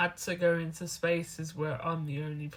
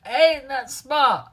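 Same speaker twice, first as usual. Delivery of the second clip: slow, much louder and higher, hammed-up pride; mock proud exclaim; 7 semitones higher, 11 dB louder, much slower than usual. Telling Japanese people about her university, hammed-up proud exclaim. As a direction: stretch it out like a proud show-off.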